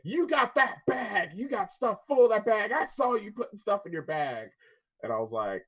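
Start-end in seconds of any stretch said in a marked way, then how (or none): 0.0-4.5 mock accusing lady. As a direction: pitch it up and drag it out, accusing. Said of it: "She's like".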